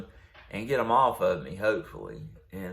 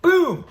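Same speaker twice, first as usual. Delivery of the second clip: much higher, louder burst; impact sound effect; 10 dB louder than usual. Retold or imitated. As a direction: make the hit explode. Second pistol hit.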